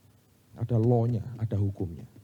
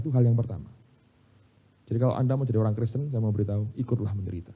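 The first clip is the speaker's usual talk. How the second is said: normal talking voice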